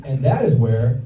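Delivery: ordinary delivery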